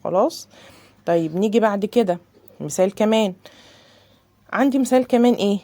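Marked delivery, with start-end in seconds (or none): none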